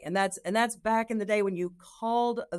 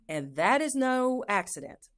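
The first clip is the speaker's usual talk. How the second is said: ordinary delivery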